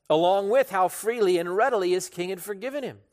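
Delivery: normal talking voice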